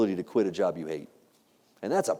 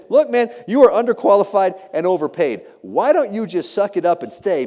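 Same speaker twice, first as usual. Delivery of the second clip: loud, higher-pitched imitated lecture; blunt scolding spouse; 7 semitones higher, 6 dB louder than usual. Wife telling husband to stay in the job, blunt scolding. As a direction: lay it on him as the no-nonsense wife.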